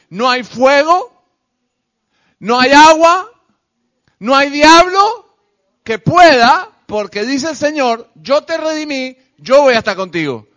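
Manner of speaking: high, loud, ringing proclamation; defiant pulpit declaration with pauses between phrases; full-force preaching. Real, not acted. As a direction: hurl each phrase out, rising to a peak.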